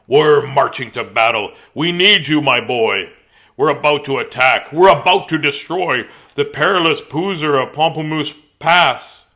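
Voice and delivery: loud, booming military speech; forceful battle address, hard emphasis; bellowing general. A narrator's reading. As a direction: bellow it like a general rallying troops. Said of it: "He bellowed".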